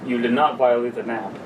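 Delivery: ordinary talk, nothing marked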